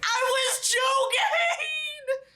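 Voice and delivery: high, slow, sobbing shout; mock crying wail. Reader mocking the hero's downfall in a high hammed-up wail.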